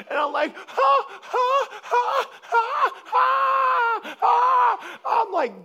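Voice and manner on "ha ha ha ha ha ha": loud, high, drawn-out forced ha-has; imitated dying laughter. Preacher showing how he was dying laughing, wailing mock laughter. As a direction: shout each laugh as if it hurts. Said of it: "dying laughing".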